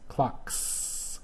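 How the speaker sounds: "Clocks" with a long, voiceless hissed S; unvoiced S ending. Shown as a demonstration. Teacher showing the unvoiced plural, "Clocks" with long hiss. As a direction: hold the S at the end of "Clocks". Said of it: "and that's unvoiced".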